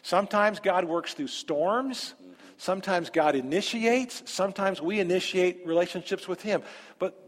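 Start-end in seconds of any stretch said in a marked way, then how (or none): none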